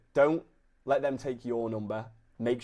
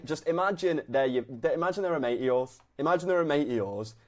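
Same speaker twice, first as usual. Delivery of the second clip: ordinary talk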